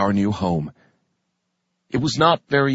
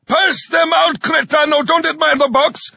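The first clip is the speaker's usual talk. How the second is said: high, loud growled orders; growling sergeant; 4 dB louder than usual. A narrator's reading. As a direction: growl it at the recruit. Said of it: "the sergeant growled".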